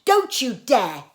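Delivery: loud, slow, outraged shout; sudden shout. Acted. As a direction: bark it out in protest. Boy wakes to stop a kiss.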